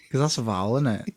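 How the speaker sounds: usual speaking voice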